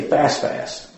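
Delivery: "fast, fast" said twice, each word plainly; repeated for emphasis. Shown as a demonstration. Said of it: "If you want to say faster".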